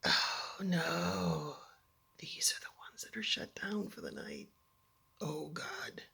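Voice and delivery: soft, sinking realization; hushed dismay. Retold or imitated. Stranded girl realizing the elevators are off, hushed dismay. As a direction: murmur it with dawning dread.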